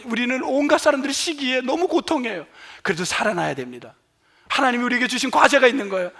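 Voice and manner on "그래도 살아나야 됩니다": ordinary delivery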